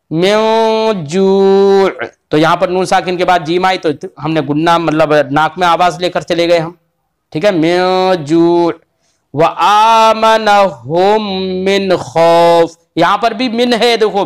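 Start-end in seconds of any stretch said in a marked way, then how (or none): 0.1-1.9 मिन जू' with the न held as a long nasal hum, very slow; nasal ghunna. Shown as a demonstration. Teacher showing a student the nasal reading of मिन जू'. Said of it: "नाक में आवाज जाएगी". 7.5-12.8 slow, measured Quran recitation. Acted. Teacher reciting the verse slowly for a student.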